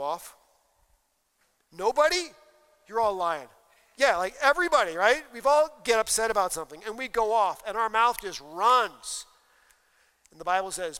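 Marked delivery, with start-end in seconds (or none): 1.7-9.3 excited call-out. Real, not acted. Teasing the congregation, calling out.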